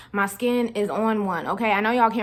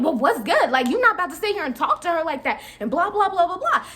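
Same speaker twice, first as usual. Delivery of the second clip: confronting coworker; 7 semitones higher than usual. Retold or imitated. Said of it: "she started bucking".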